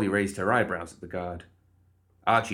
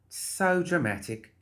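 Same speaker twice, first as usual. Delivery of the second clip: amused dismissal; 7 semitones higher than usual. A narrator's reading. Molly to the howling guard, chuckling dismissively. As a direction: brush it off, pitched up and amused. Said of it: "Molly chuckled".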